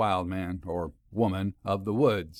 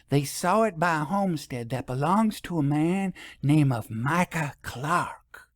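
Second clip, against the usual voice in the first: higher, confidential countrywoman sharing a rumour; lightly voiced storekeeper, slowing on the name Micah Clark; a hint of a small-town gossip telling a secret; gossiping townswoman; 6 semitones higher than usual. A narrator's reading. Narrator holds Hooper's voice.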